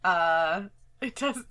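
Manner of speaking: normal talking voice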